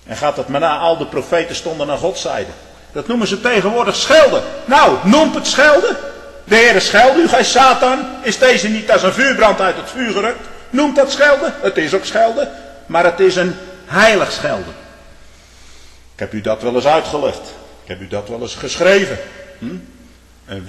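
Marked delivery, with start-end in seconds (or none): 2.9-14.7 loud, high fire-and-brimstone preaching; preaching at full force. Acted. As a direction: thunder it from the pulpit. Preacher defends holy rebuke.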